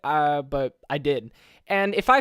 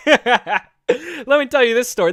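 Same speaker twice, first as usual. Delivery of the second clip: laughing intro; 6 semitones higher, 6 dB louder, slower than usual. Real, not acted. Laughing as he starts the tale.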